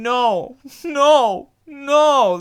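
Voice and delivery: high, loud mock wail of denial; hammed-up distraught protest; dramatic moviegoer. Mimicking the guy behind him in the theater, dramatic. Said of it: "at this dramatic moment".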